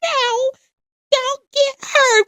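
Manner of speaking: lightly mimicked high-pitched grandma. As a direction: retell it in a high, drawn-out pleading call. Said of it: "My grandma was like".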